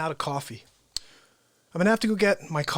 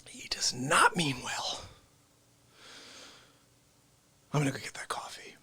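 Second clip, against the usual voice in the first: whispering; 5 semitones lower, 7 dB quieter than usual. Real, not acted. Warning about Dick Face.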